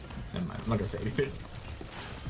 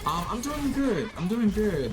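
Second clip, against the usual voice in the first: high chirpy reply; 8 semitones higher than usual. Pretend.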